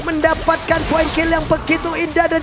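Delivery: steady play-by-play narration; esports caster. Acted. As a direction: call the fight move by move. Live play-by-play casting.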